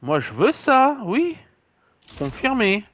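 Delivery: high, eager pick; excited. Real, not acted. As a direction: squeal it out with glee. Finally picks the item he wants.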